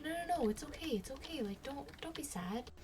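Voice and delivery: put-on calm a crying kid down gently; soothing. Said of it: "she was like".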